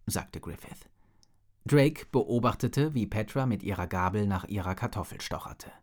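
plain reading voice

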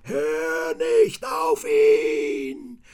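a shrill demon howling. A narrator's reading. Demon figure's voices.

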